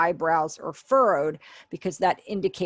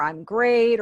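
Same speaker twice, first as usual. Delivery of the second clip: louder, bright and pleased; upbeat great; 4 semitones higher, 7 dB louder than usual. Shown as a demonstration. Teacher demonstrating an upbeat reply to students. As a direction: show you feel great. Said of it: "responding with an".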